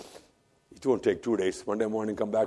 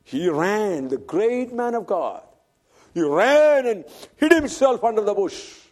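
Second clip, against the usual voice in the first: raised, ringing preacher's voice; preaching at full force; 9 semitones higher, 6 dB louder than usual. Acted. Preacher retelling Elijah's flight to the congregation, preaching at full force. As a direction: proclaim it to the congregation.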